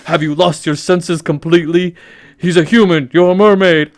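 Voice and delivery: loud, hammed-up angry scolding; a furious father king laying down the law; king shouting at his mermaid daughter; King Triton scolding. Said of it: "King Triton".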